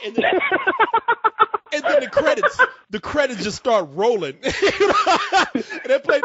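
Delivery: cracking up, high-pitched; real helpless laughter breaking up the words; laughing while talking. Real, not acted. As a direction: struggle to speak through laughter. Cracking up while retelling the ending.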